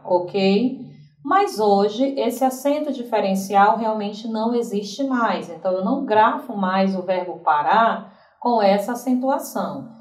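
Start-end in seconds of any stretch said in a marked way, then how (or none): none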